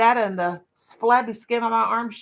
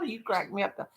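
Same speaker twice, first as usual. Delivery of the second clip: ordinary talk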